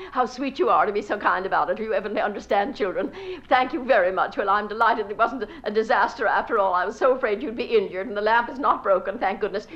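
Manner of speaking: loud, rapid gushing apology; flustered apology. Acted. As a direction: pour out apologies breathlessly to the hostess. Mortified apology to hostess.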